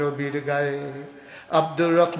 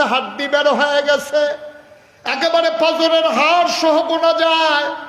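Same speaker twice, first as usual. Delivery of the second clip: high, loud anguished outcry; full-force preaching; 13 semitones higher, 10 dB louder than usual. Acted. Prophet calling his starved companion to him, high wailing shout. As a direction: call out to a wasted, beloved companion.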